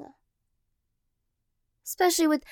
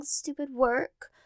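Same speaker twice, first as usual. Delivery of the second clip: ordinary talk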